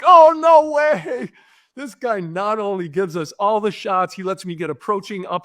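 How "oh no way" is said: stunned disbelief. Retold or imitated. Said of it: "my guys are like".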